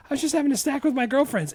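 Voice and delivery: put-on high, quick teen-girl voice; acted daughter's excuse. Acting Cher's excuse to her dad.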